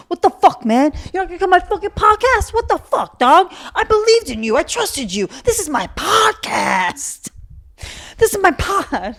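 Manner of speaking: high, loud mock meltdown; overacted, high-pitched indignant tirade; hammed-up outrage. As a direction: play betrayed and wailing, way over the top. Imagine if I cared that much.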